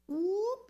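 quick high-pitched whoop. Acted. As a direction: let out a sudden high whoop. Preacher's whoop as door opens.

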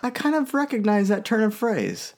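Violet. Retold or imitated. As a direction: lift the pitch to voice an anime heroine. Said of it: "Violet's like".

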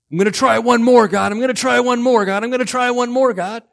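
high, loud, repeated grunt of determination; strained effort. Acted. Acts frantic people straining on alone.

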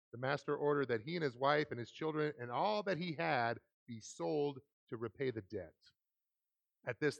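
normal talking voice

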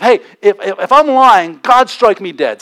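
acted emphatic self-curse; swearing an oath. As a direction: protest loudly, staking your life on it. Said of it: "began to invoke a curse on himself".

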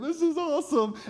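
high-pitched retold excitement; excited. Preacher cheering with his friend on the porch, excited. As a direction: let the thrill lift your voice way up.

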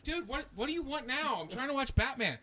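put-on a boy annoyed by his penis; annoyed kid. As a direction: whine like a kid interrupted at TV. Annoyed kid self quote.

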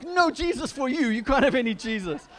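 loud, high, hammed-up parental refusal; exasperated parent shouting, played for laughs; mock-stern parents. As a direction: scold a child at the top of your voice. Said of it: "We're like".

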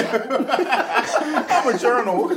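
slow mock voice. Mock depressed diary.

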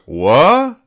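high, loud, questioning yelp; sharply rising, incredulous exclamation; startled dog. Acted. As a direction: blurt it out as a baffled cartoon dog. Dog's startled line in comic strip.

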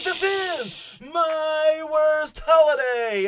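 big, drawn-out podcast intro call; hyped title reveal, long held words; show-title announcement. Pretend. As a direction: stretch the title out high like a ring announcer. Host announces the show's title.

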